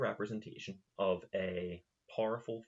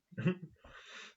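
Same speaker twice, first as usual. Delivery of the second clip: breathy laugh; 11 semitones higher than usual. Real, not acted. Laughing after own impression.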